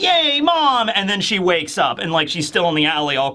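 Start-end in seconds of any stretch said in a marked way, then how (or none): 0.0-0.9 high, delighted mock cheer; high-pitched yay; child's joyful cheer. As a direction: cheer it like a happy little girl. Child Robin cheering in dream.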